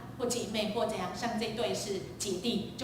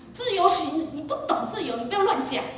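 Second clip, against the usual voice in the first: high, loud lecturing snap; scolding father; 7 semitones higher, 4 dB louder than usual. Retold or imitated. Father telling his child they don't understand freedom, scolding. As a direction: put the kid in their place.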